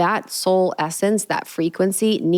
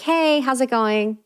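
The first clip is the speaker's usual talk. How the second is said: higher, slow and sweet; flirty crush voice; 6 semitones higher, slower than usual. Retold or imitated. Woman greeting her crush, higher and sweet. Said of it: "talking to our crush".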